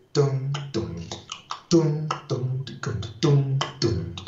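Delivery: evenly paced rhythmic dums; vocalized bass line. Shown as a demonstration. Teacher showing the accented one, rhythmic dum sounds. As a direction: imitate the bass beat with dum. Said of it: "that one is accentuated".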